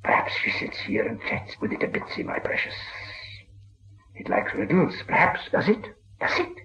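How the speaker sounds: hissed, wheedling and voiceless, lifting on the questions; oily polite Gollum whisper. A narrator's reading. Gollum sweetly coaxing Bilbo into riddles, hissing. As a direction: coax it like a sly cave creature. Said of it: "became quite polite".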